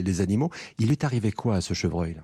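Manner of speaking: normal talking voice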